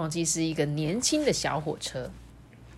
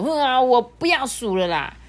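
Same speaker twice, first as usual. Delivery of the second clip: higher, louder strained cry; frustrated outburst; 6 semitones higher, 5 dB louder than usual. Acted. Engine giving up counting loops and charging on, louder and higher. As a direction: push with all his might. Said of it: "用最大的马力说".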